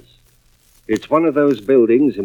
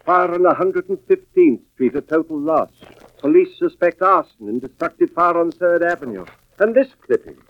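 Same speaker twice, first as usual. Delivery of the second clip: higher, tense voice as each find piles up; rising alarm; 9 semitones higher than usual. Acted. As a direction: pull each item out with mounting shock. Husband finds fire clippings in purse.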